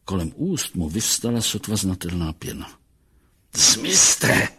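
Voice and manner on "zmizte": loud, sharp command; sudden forceful outburst; snapping at them. A narrator's reading. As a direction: lash out and order them away. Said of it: "obořil se na těla".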